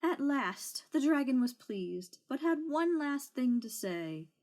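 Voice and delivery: ordinary delivery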